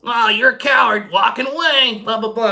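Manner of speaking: mock her calling you a coward; sneering imitation; mocking taunt. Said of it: "I don't give a shit".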